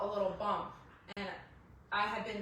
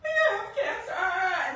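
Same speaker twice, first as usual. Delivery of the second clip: slow, high, loud half-laughing sob; crying wail; 7 semitones higher, 8 dB louder, much slower than usual. Retold or imitated. She tells her husband she has cancer, crying wail. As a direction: wail it out, laughing through tears.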